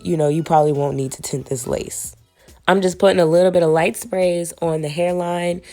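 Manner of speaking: ordinary delivery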